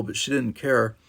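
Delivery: ordinary delivery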